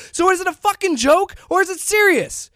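high-pitched, indignant challenge; heated confrontation, pitched well up; yelling rant. Real, not acted. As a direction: fire off an angry, sarcastic question. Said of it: "yelling at Glenn Beck".

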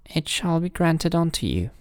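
high, regal granting voice; gracious king. A narrator's reading.

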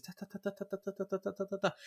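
soft rapid tapping sounds; quiet typing noises. Retold or imitated. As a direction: tap out typing sounds softly. Imitating typing for months.

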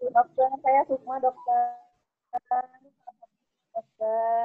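faint, distant voice on the call; speaking far from the phone mic; phone held away. Real, not acted. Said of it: "Suaranya kecil?".

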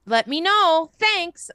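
high-pitched, chirpy email sign-off; exclamation-point perky. Acted. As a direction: sing out a peppy, apologetic work email. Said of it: "Exclamation point".

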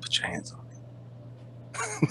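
slow, breathy mock-menacing whisper; drawn-out menacing whisper. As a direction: hiss it slowly and softly. Mock threat whispered slowly.

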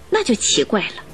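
ordinary delivery

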